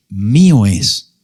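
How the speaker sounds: deep, slow voice of God; low and slow, as God claiming. Acted. Preacher voicing God to the congregation, deep and slow. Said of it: "Dios dice".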